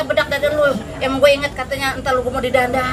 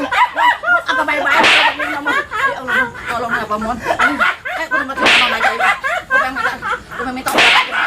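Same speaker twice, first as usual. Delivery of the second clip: high whimper; 10 semitones higher than usual. Acted. Moans he is dying, begs.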